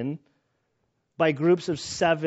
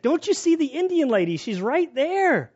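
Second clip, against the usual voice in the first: frantic, imitated; 11 semitones higher, 3 dB louder than usual. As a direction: plead high and insistent, pointing. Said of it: "And he gets frantic".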